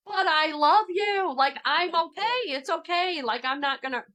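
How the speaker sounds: high, pleading reassurance; mother begging the sobbing boy to trust her, high pleading voice. Retold or imitated. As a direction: coax a frightened child, high. Mother pleading with screaming child.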